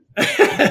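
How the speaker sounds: short airy laugh high up. Real, not acted. Recalling not knowing what net worth meant, airy laugh. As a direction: laugh at how naive you were.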